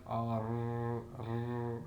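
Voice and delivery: "R" drawn out into a rolled r sound. Shown as a demonstration. Lecturer showing what a rolled letter need not be, rolled "R". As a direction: hold the r at the end of "R". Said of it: "does not actually have to be rolled".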